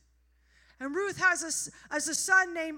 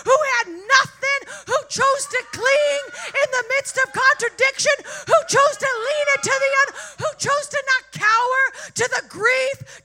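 much louder, higher fervent preaching; preaching at full force; 6 semitones higher, 12 dB louder than usual. Real, not acted. Preacher lifting the congregation to the climax, shouting. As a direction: drive the climax home to the church.

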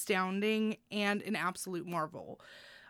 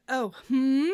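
a mock surprised "Oh, hmm?" with a little cry; reading own note aloud; 4 semitones higher, 6 dB louder than usual. Host reads her written reaction, a touch surprised. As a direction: voice your own puzzled note with a rising sigh. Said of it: "I put".

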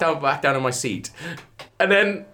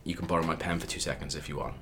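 offhand quiet offer; 11 semitones lower, 13 dB quieter than usual. Retold or imitated. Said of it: "he was like".

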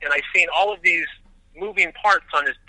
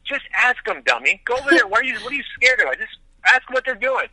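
higher, pushy inner voice egging him on; a mock-scolding second voice in his head, pitched higher; bossy inner voice; 7 semitones higher than usual. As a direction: nudge yourself to just go ask, half-scolding. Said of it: "this other voice came into my head and said".

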